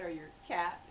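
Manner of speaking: ordinary talk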